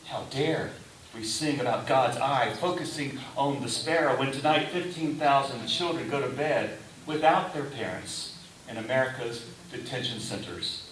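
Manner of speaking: normal talking voice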